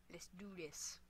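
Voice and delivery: low, very soft whispered pep talk; hushed whisper. Real, not acted. Player psyching up before a round, hushed whisper. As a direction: whisper it under your breath.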